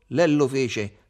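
ordinary delivery, nothing marked

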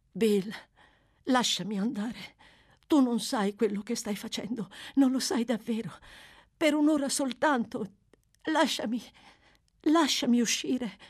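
high, urgent begging in broken phrases; pleading. Acted. Nancy begs Sykes to let her go.

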